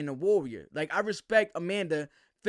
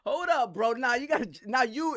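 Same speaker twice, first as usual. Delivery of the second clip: higher, laughing hold-up as the joke lands; sudden double take; 5 semitones higher than usual. Retold or imitated. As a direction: catch the joke mid-sentence and stop. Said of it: "I said".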